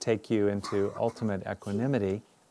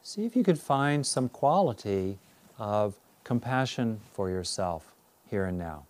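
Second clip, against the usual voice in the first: guided meditation. Real, not acted. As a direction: guide them inward, unhurried and measured.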